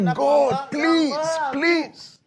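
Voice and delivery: high, drawn-out begging; acted desperate pleading, voice pushed up high; wrestling plea. Preacher voicing an intercessor wrestling with God, pleading. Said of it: "can you wrestle inside there with God".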